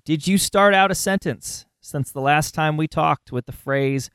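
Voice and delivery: ordinary talk, nothing marked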